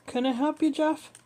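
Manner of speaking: high-pitched, helpful receptionist question; female receptionist. A narrator's reading. Receptionist's voice in the story.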